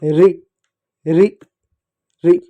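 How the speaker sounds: "रि" as a short, rolled "ri"; isolated short "रि" for pupils to copy; demonstrated syllable. Shown as a demonstration. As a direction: say "रि" briefly, one at a time with pauses. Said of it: "और उच्चारण कैसे होता है".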